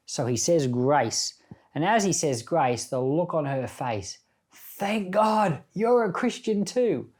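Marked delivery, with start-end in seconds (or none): 4.5-7.1 high, bright burst of relief; relieved joy. Retold or imitated. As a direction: exclaim it like she's saved. Wife relieved at husband's grace.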